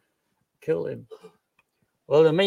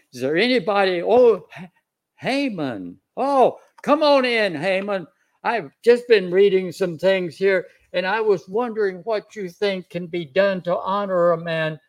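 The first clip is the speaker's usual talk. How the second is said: higher-pitched, animated greeting to a courtier; welcoming king; 5 semitones higher than usual. Acted. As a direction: call him in as if just spotting him. Voices king greeting Haman arriving.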